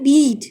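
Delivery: बीट with a long "ee"; shown pronunciation. Shown as a demonstration. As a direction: say बीट clearly with a long vowel. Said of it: "इसको हम पढ़ते हैं". (बीट को लंबी "ई" से बोलें)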